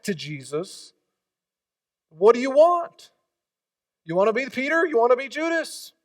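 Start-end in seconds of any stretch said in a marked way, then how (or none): 2.1-5.9 higher, louder pointed questions; challenging call. Real, not acted. Pastor challenging his congregation, loud and high. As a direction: put the choice to them hard.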